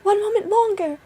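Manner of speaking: very high, loud stalling cry; pleading wife. A narrator's reading. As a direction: shout back, begging for time.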